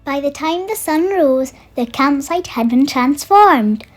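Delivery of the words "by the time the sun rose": usual speaking voice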